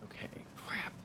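tense whisper. Real, not acted. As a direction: whisper it in fear. Nervous whisper near enemy.

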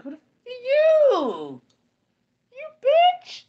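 very high, shrieking curse at the ghost; furious high-pitched yelling; cursing her out. Retold or imitated. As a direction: scream the insult at the bride. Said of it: "I, I cursed her out".

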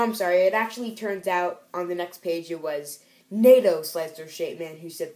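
normal talking voice

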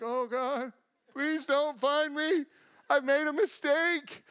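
high-pitched mock desperate plea; hammed-up frightened kid begging, drawn out; panicked pleading. Hiding boy praying not to be found, high mock panic.

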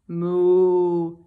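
low loud moo; cow mooing. Acted. Cow agreeing to the plan with a moo. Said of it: "said the cow".

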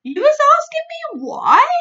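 loud, high astonished giant; astonished big friendly giant; giant crying out. Acted. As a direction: cry out in disbelief. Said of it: "cried the B F G".

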